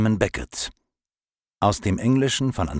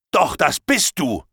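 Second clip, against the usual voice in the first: higher, louder furious retort; angry shout; 9 semitones higher, 5 dB louder than usual. Acted. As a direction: snap back in rising anger. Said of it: "da wurde ich noch wütender als vorher". (wütend und laut zurückgerufen)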